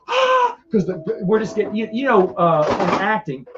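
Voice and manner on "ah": sudden high, loud cry of "Ah"; retold gasp yell. Retelling the skit trio, a high loud gasped cry. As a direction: belt out a startled cry. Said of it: "all three of us just going".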